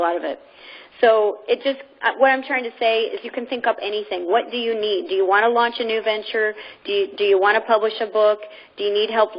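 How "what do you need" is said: ordinary talk, nothing marked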